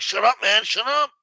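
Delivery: hammed-up shut up, we gotta go; mock Bruce Willis. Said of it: "Bruce Willis is like".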